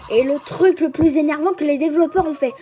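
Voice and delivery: usual speaking voice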